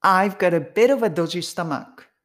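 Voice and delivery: "bit of a" run together as one word; connected speech. Shown as a demonstration. Third repeat-after-me take for learners, words joined up. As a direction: link "got a bit of a" with flapped t's. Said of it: "つなげていくような発音に".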